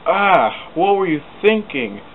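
high, loud exasperated cry; angry cry. Acted. Thomas to James after the crash, high and loud. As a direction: hurl the question at him. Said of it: "cried Thomas".

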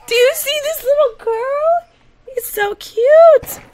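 very high, loud, giggly gushing; gushing squeal. Real, not acted. Gamer showing viewers a little girl, gushing squeal. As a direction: squeal at the cuteness, stretching the end. Gushing over toddler in game.

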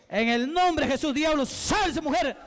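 forceful, high-pitched deliverance commands; exorcism preaching. Real, not acted. Preacher to a demon he is casting out, commanding. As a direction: order the demon out in rapid, insistent bursts.

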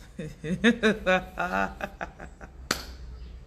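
long giggling laugh trailing off. Real, not acted. Coach amused at her own teasing of Gabonese listeners, laughing at length. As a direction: burst out laughing, let it die away.

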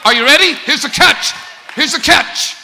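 high, loud preaching shout; full-force preaching. Real, not acted. Preacher driving the point home, high loud shouting. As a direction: preach it at the top of the lungs.

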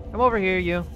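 normal talking voice

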